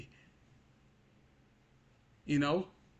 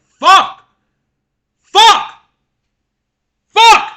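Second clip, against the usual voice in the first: real full-force high-pitched scream; angry scream; 24 dB louder, much slower than usual. Real, not acted. Venting to viewers about being so angry, screaming in rage. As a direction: explode in a very loud, high yell. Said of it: "I'm just so fucking angry".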